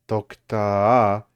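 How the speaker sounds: loud final stress, pitch kept level; stressed by volume. Shown as a demonstration. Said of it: "it's more, I guess, about the volume".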